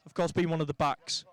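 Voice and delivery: normal talking voice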